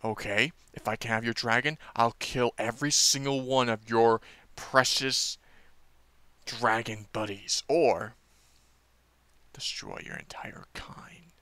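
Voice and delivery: quiet villain threat that sinks to a whisper. Retold or imitated. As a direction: menace softly, then whisper the last words. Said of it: "And he's like".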